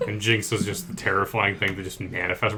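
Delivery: normal talking voice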